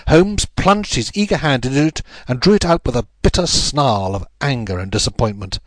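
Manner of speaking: ordinary delivery, nothing marked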